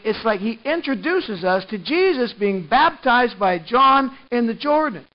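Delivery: normal talking voice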